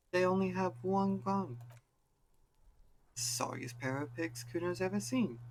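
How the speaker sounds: put-on high, bratty punk kid voice; Kuno taunting. Kuno jeering at the cops, high and bratty. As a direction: sneer it like a cocky street kid.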